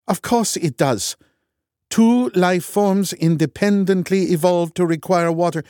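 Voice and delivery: heated. A narrator's reading. Heated scientific argument.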